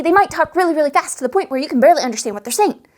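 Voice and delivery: rapid-fire, words tumbling out; hurried excitable chatter; very fast talk. Shown as a demonstration. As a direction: rush it like an excitable NPC. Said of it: "You see the difference ... how fast".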